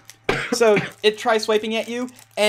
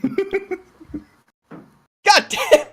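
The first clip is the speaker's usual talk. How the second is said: real laughter breaking into high-pitched words; laughing exclamation; 13 semitones higher, 5 dB louder, much slower than usual. Real, not acted. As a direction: exclaim it while cracking up. Player cracks up at the game.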